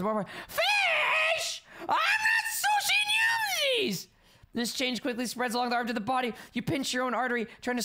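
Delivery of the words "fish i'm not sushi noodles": high, loud cry of protest, stretched slowly; panicked shout. Pretend. Player reacting to the transformation with a high panicked shout. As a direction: shriek in mock horror at the scales. Panics as arm becomes scales.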